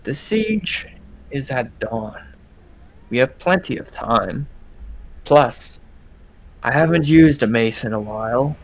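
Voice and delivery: a cocky fighter shrugging it off; female warrior. Acted. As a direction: female fighter brushing off the warning. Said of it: "you hear the female voice again".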